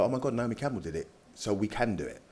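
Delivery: ordinary talk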